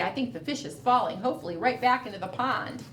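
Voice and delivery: ordinary delivery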